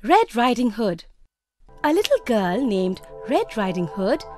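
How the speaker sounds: ordinary delivery, nothing marked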